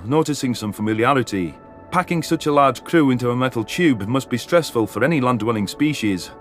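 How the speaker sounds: ordinary talk, nothing marked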